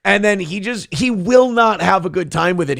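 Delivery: ordinary talk, nothing marked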